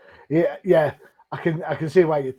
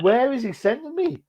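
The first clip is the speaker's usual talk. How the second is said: puzzled; 11 semitones higher than usual. Retold or imitated. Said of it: "I was like".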